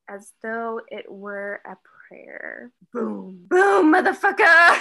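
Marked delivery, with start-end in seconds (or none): 2.9-4.8 loud laughing shout; excited outburst. Real, not acted. Reader bursting out at the explosion, laughing shout. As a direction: yell it out with a laugh.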